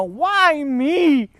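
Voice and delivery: loud, high cry up at the sky; drawn-out, high, anguished shout. Acted. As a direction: plead loudly to a silent heaven. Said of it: "look up at the sky and I'd go".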